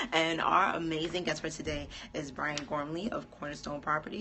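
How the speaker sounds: ordinary talk, nothing marked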